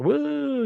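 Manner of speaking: long, high-pitched whoop; celebratory woo. Retold or imitated. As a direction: imitate her wooing with a drawn-out "woo". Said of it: "wooing at that point".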